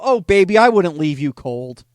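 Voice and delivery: loud, hammed-up crooning come-on, slowing at the end; mock seductive. He flirts with the woman going ghost, in a loud mock croon. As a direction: lay on the charm, teasingly loud.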